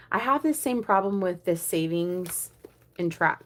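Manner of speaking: ordinary delivery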